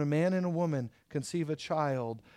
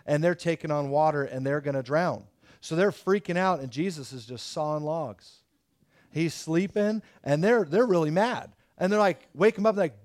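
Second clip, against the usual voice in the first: ordinary talk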